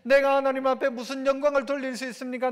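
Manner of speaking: ordinary delivery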